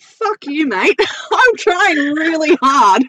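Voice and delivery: high, loud laughing outrage; mock indignant laughing. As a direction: play up the protest through laughter. Retold indignant reply, laughing.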